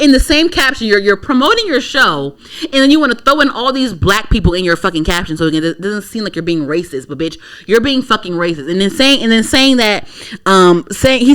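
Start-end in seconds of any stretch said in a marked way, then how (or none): none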